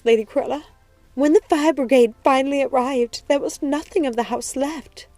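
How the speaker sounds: high-pitched tearful account, easing from loud wail; distraught servant gasping out the story; sobbing, heaving breaths. A narrator's reading. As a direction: tell it while crying hard. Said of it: "She told the story between great heaving breaths".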